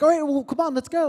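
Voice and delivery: high, loud, perky imitation of her; eager urging. Girl hurrying him out the door, eager urging. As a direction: hurry him along brightly.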